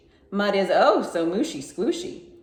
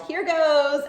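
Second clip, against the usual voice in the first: higher and louder, a bold cry; yelled; 9 semitones higher, 5 dB louder than usual. A narrator's reading. Brother holding his nose, yelling before jumping. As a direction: call it out before a big jump. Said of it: "He held his nose and yelled".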